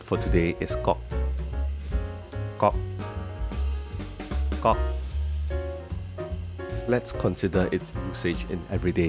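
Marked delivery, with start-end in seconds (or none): 0.8-4.8 short sharp kok knock; Singlish sound word. Shown as a demonstration. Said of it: "The second sound word".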